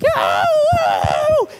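high, loud cheering yell; excited kids' whoop. Retold or imitated. Kids cheering as they see bicycles, big excited yell. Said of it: "and they're just like".

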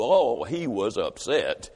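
low and slow, milking it; drawn-out refrain. A narrator's reading. Speaker to audience about the upset boss, low and slow. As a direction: drag it out low for the crowd. Refrain after each angry quote.

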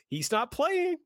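singsong dismissive imitation; mocking quote voice. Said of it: "I wouldn't say it in that voice".

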